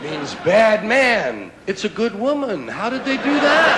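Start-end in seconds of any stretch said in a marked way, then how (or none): 2.7-3.8 loud, high, indignant question; mock outrage. Comic asking the crowd, loud and indignant. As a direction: demand it like an outraged man.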